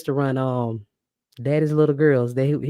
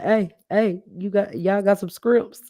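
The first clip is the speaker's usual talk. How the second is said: mimicking Buddy shouting; 7 semitones higher, slower than usual. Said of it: "Y'all remember he was like".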